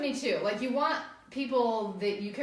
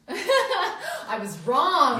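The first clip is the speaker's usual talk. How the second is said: laughing; 5 semitones higher, 5 dB louder, much slower than usual. Real, not acted. Admits with a laugh.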